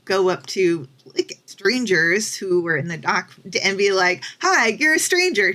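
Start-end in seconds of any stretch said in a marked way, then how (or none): 4.4-5.5 high, chirpy pretend hello to a stranger; hammed-up friendly approach; mock eager greeting. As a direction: parody a forward fan. Mocking herself approaching a stranger.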